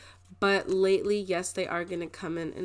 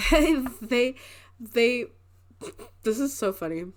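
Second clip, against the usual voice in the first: laughing; 4 semitones higher, 5 dB louder than usual. Real, not acted. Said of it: "I don't know why I'm laughing".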